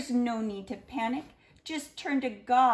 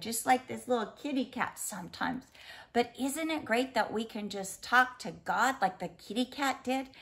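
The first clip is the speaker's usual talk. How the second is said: usual speaking voice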